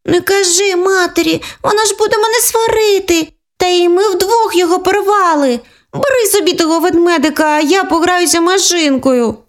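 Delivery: put-on high anxious child voice; pleading little girl. Younger sister pleading with her sister, high child voice. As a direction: beg her not to tell. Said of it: "запропонувала Світланка".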